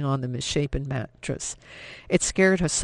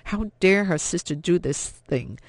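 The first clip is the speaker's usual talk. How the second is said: ordinary delivery, nothing marked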